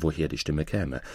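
ordinary delivery

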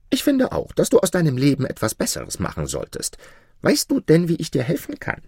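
high-pitched spirit voice; helpful jinni. A narrator's reading. Jinni asks Maruf how to help, in spirit voice. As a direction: advise him in a high creature voice.

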